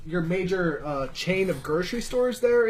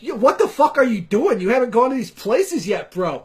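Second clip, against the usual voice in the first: loud, high imitation of a pushy Boston guy; mock Boston bro; 4 semitones higher, 5 dB louder than usual. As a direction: bark it like an offended local. Boston locals scolding the newcomer.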